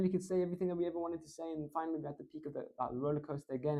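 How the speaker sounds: fast rush of words; really fast. Shown as a demonstration. Speaker showing the audience a fast build-up, rushed. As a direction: tumble it all out quickly. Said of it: "we can go really fast again".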